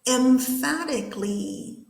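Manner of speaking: "Emphatically" said slowly, every syllable distinct; model pronunciation. Shown as a demonstration. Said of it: "one more time".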